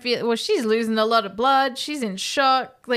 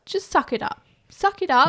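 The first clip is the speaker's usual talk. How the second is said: higher, insistent acted order, building; mock tough love; 6 semitones higher than usual. Rob to grieving friend, lightly acted impatient order. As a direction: push harder on the repeat. Acts Rob urging Hud on.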